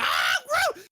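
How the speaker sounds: very high, loud shouted cry; Groot's roar. Retold or imitated. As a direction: yell it like the tree creature charging. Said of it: "he's like".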